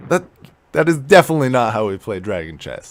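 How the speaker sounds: loud, fast objection; forceful, rapid correction; indignant protest. Acted. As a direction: protest hotly at a cheating opponent. Player disputes goblin's illegal move.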